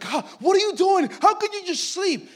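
loud, high, incredulous yell, imitating the friend; alarmed outburst. Friend demanding to know how he slept, shrill-pitched mock shout.

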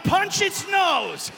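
high, loud, slow panicked yell; frantic shout. Retold or imitated. Retelling yelling at his family while swimming, slow frantic shouts. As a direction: bellow it at the kids in the surf.

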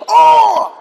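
loud, high groaning cry; groan shout. Retold or imitated. Preacher acting Jesus groaning, loud cry. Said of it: "He says".

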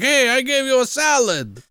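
high-pitched indignant whine in jest; mock indignation. Diner to the man who kicked his salad, mock indignant. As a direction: protest like a wronged benefactor, hammed-up.

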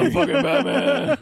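high, loud mock bellow; imitating Batman. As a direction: yell it over the top, near laughter. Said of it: "the way he says".

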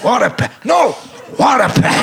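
high, shouted "what a pair"; line reading. Retold or imitated. Director insisting on the reading, high shout. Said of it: "And I go".

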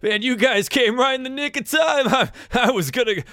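high, laid-back stoner dude voice; a grateful beach bum, way up high; surfer dude. Acted. GM voices Floon thanking party.

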